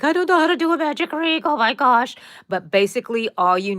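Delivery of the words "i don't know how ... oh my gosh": high, loud, fast mock panic; hammed-up fluster, much higher and louder. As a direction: act out your old flustered beginner self. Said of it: "because I was like".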